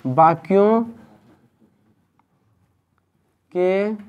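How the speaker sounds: word by word with very long pauses; dictation. Real, not acted. Teacher dictating a definition to students, slow dictation. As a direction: dictate slowly, leaving time to write.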